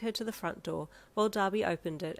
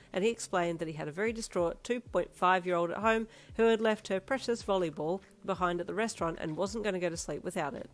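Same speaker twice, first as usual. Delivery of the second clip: plain reading voice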